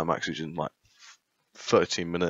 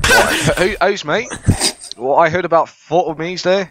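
put-on Australian accent; 2 semitones higher than usual. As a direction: play an Aussie official in an Australian accent.

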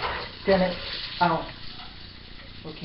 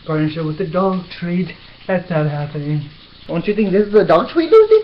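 usual speaking voice

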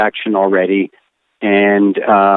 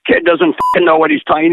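raised pitch, faster, scornful mock rant; imitating an angry yell; 7 semitones higher, faster than usual. Producer yelling at the young animator, mock angry rant. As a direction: fire it off like a producer losing his temper. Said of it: "Joe Pytka started yelling at me like".

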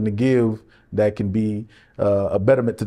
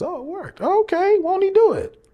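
high-pitched mock joy; delighted. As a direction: squeal the good news. Acting out relief.

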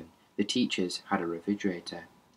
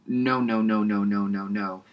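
nervous denial. Acted. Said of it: "shaking his head, half smiling".

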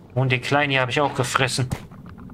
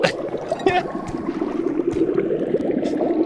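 put-on high breathless drunken titter; drunken giggle. Drunk crab giggling at the alcohol, high titter. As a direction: giggle like you've had too much.

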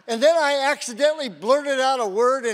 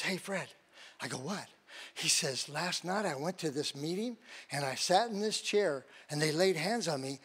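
much softer and lower, hushed telling; confiding quietly; 4 semitones lower, 6 dB quieter than usual. Retold or imitated. Coworker confiding his baptism story to Fred, hushed and low. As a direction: draw him aside and tell it low.

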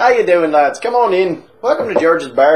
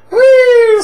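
much higher, much louder squeal of glee; gleeful whoop; 11 dB louder than usual. Acted. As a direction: whoop like a drunk swinging off the bar. Jumps on the bar swinging.

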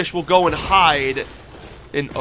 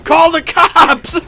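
much higher, louder slow shout; mock panicked yelling; 12 semitones higher, 7 dB louder, slower than usual. Yelling at a weird game cat, loud mock panic. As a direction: yell for your mother in mock panic.

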